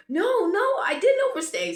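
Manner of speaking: high, loud retold protest. Traveler denies overstaying to border guard, loud protest. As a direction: cut in with an insistent denial.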